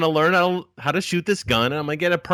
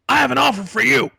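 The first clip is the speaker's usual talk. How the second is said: put-on abrupt, fast, high old man; father-in-law voice; 10 semitones higher, 6 dB louder, faster than usual. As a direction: blurt the offer like a pushy old man. Father-in-law voiced on phone call.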